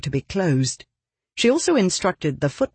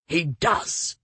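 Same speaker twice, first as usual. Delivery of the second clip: grudging, strained and quiet; choked voice; 4 dB quieter than usual. A narrator's reading. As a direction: force the words out reluctantly. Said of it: "speaking through a mouthful of sand".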